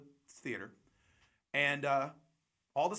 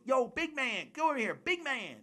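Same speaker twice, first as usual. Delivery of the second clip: high-pitched hollered hype-man call; pitched way up, hailing someone across the room; 11 semitones higher than usual. Acted. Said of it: "That was a good [name] voice".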